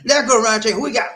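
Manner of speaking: loud, high roll-call holler; hyped shout-out. Real, not acted. Host calling out viewers by name, hyped shout-out. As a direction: belt out the names like a hype man.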